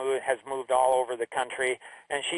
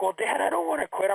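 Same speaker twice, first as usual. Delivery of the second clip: lightly imitated kid, pitched higher; higher mock-upset daughter voice; protesting daughter; 7 semitones higher than usual. Retold upset daughter answers dad.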